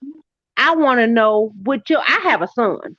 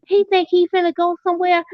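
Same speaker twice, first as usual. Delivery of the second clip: high, scornful put-on voice; mocking wife; 7 semitones higher, faster than usual. Wife sneering about husband leaving, high mock voice. Quoting abusive wife's retort.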